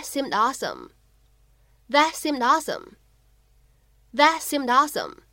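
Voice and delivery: "that seemed awesome" with the t and d held back and "seemed" linked into "awesome"; linking demo. Shown as a demonstration. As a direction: hold the stops in "that seemed", link into "awesome". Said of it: "我们呢其实可以读成that seemed awesome". (示范"that seemed awesome"的不完全爆破和连读)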